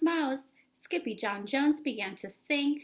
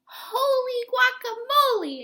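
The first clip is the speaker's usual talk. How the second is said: much higher, loud startled cry; astonished exclamation; 13 semitones higher, 8 dB louder than usual. Acted. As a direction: yelp in pure surprise. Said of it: "exclaimed Skippy John Jones".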